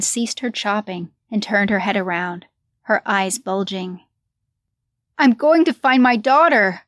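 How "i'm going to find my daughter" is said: high, loud frantic scream. A narrator's reading. Crazed mother screaming at the broker about her daughter. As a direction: scream it, half mad with grief. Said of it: "she screamed".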